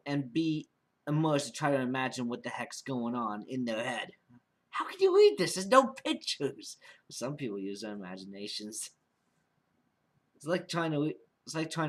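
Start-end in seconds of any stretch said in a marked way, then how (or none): 4.7-6.8 high, loud, scoffing put-on voice; mock skeptic voice. Mocking a doubter who thinks audio stories need pictures, high scoffing voice. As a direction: squeak it like a sneering doubter.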